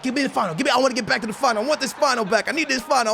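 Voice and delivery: high, urgent, fast-building plea; desperate pleading. Retold or imitated. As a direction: beg for it like you can't let go. Acting out his desperation for the final.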